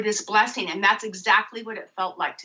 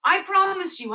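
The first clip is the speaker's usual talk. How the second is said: high pleading voice, much higher; imitating the local man; 11 semitones higher than usual. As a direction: plead high like the eager vendor. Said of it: "He goes".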